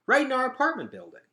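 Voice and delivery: high, fast, loud blurt. A narrator's reading. Gregor blurting to Vickis about his building. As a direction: blurt it out in alarm. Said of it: "Gregor blurted out".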